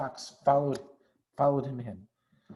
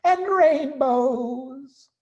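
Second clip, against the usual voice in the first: rapturous; 6 dB louder, slower than usual. Acted. Badger rhapsodising over cider.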